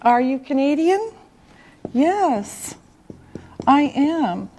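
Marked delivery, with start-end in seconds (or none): none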